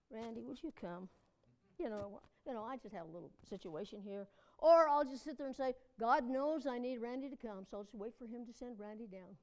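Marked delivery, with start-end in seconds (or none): 0.1-4.3 soft, low, casual little plea; understated, hesitant appeal in a lowered voice; mock meek request. Acts out too-casual plea for help. 6.0-9.4 softly musing, dropping away; low-key, passive acceptance, fading at the end; mock patient resignation. Acts out passive waiting on God.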